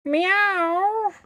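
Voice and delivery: high mock cat meow; nervous cat voice. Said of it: "perfect goodbye from Buzz".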